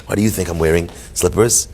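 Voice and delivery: low-pitched, lightly imitated English accent. English rabbi answering a question about slippers, mock English accent. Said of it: "he goes in his beautiful English accent".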